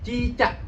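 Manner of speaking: "cicak" high, "cak" wide open; cicak terbuka. Shown as a demonstration. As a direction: articulate "cicak" with an open ending. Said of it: "caknya itu dibuka mulutnya". ("cicak" dengan "cak" dibuka)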